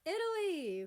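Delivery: delighted shout of recognition; excited call. Acted. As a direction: hail an old friend across the room. Spotting old classmate, calls out.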